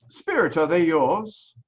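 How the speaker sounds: ordinary delivery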